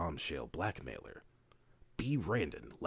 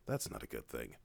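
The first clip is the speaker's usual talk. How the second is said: ordinary talk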